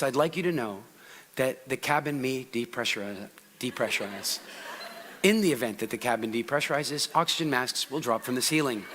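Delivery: low, soft droning monotone; monotone speaker. Shown as a demonstration. Mocking a dull Toastmasters speaker, low droning monotone. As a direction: bore them like a flight attendant. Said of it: "most speakers will come out and they will deliver their talk right here".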